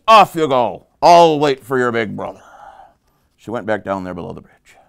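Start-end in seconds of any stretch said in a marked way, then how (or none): 0.1-2.4 loud, forceful troll order; troll dismissing. Acted. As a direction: send the goat off in a big troll voice. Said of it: "So he says".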